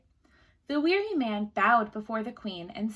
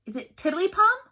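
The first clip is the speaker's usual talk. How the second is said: queen guessing; 9 semitones higher, 7 dB louder than usual. A narrator's reading.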